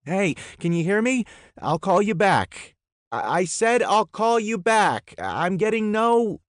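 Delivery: very high, loud phone shouting. Acted. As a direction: yell over a dropped call. Shouting into bad phone line.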